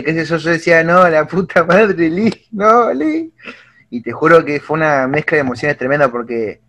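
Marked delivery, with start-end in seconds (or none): 0.8-3.3 pleading, climbing much higher toward the end; begging a beaten hero to stop; retelling his despair at the fight; anguished plea. Retold or imitated. Anguished at Lee's defeat.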